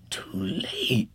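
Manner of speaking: frightened breathless whisper. A narrator's reading. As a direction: whisper it in dawning terror. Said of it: "he whispered".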